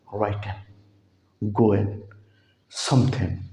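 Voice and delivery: राइटिंग, गोइंग, समथिंग ending in en; soft en endings, राइटिंग drawn out; correct -in ending. Shown as a demonstration. Teacher repeats the right endings for students. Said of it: "इंग नहीं है".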